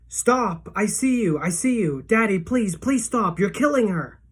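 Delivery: high, loud pleading shout; desperate scream. A narrator's reading. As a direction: beg him to stop at the top of your voice. Boy screams at father choking Loretta.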